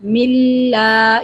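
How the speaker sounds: "ملا" with the long la lifted and held. Shown as a demonstration. Teacher shows the upward stretch, long light "ملا". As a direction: raise the voice into a drawn-out "ملا". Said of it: "آواز کو بلند کرنا ہے اوپر کو".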